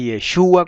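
Yeshua stressed on SHU. Shown as a demonstration. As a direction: say Yeshua with the accent on the U. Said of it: "con acento en la U".

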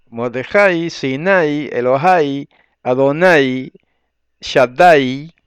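emphatic list, Mordejai, Sinai, Elohai, Adonai, Shaddai each accented on the final A; stress on A. Shown as a demonstration. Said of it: "Siempre el acento va en la A".

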